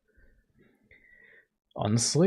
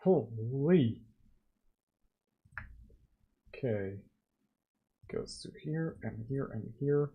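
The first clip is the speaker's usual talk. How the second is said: hiccups; 5 dB quieter than usual. Real, not acted. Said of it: "I keep hiccuping".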